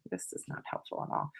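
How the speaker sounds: hushed grumble to herself; muttered aside. Real, not acted. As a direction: say it under your breath. Aside muttered under breath.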